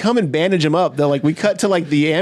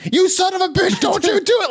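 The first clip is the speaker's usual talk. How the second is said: mock furious shout. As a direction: scream it down from the chair, hammed-up. [name] on chair threatens kid.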